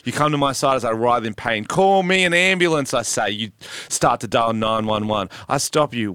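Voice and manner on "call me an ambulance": high, loud hammed-up plea for help; mock pained cry. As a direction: cry out as if in pain, half joking. Narrator voices injured self.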